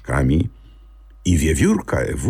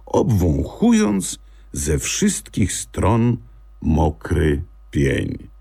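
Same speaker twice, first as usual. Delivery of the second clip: ordinary delivery, nothing marked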